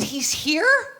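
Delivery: high, rising, incredulous question; stunned disbelief. Retold or imitated. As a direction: ask it as if you can't believe it. Stunned on learning Ali is there.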